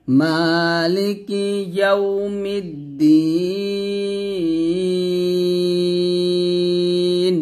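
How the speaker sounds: মালিকি ইয়াউমিদ্দিন chanted very slowly, the দ্দি vowel held three beats; slow melodic Quran chant with a long madd; drawn-out recitation. Shown as a demonstration. Teacher models beautiful reading for students, slow chant. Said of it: "এভাবে সুন্দর করে পড়বেন".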